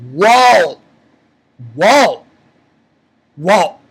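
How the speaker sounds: three loud, separate whoas; stunned, punchy exclamations; amazed reaction. Retold or imitated. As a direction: blurt each whoa loudly with a pause after.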